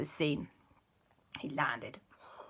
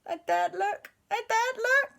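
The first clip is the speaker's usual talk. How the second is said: high gasping disbelief; look, look, in shock; aghast aunt. Acted. Aunt Lisa to everyone, high aghast cry.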